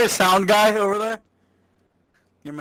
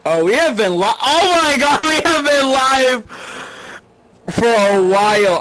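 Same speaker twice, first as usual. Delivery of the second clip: embarrassed shock; 7 semitones higher, 7 dB louder than usual. Real, not acted. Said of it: "I am so sorry, everybody".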